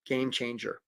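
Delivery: low, soft and understated; quiet, low-key delivery; hushed aside. Real, not acted. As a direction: drop it quietly, almost to yourself. Key phrase said low, then repeated loudly.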